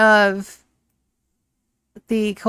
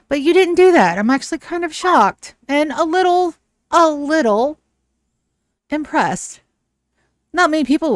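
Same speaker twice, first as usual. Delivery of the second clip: higher, louder emphatic villainess; theatrical lady, stressing her surprise; shocked, impressed lady; 7 semitones higher, 4 dB louder than usual. Acted. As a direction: play up being shocked and grudgingly impressed. Game master voices surprised Ellie.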